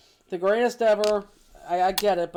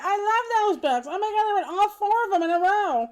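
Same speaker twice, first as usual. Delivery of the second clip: gushing fan; 13 semitones higher, 6 dB louder than usual. Retold or imitated. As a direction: gush like a smitten reader. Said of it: "she's like".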